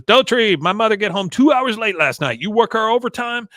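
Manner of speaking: loud, high mock-angry character voice; Con, pissed. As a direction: storm in with a high, loud imitation. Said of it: "Con is coming up pissed".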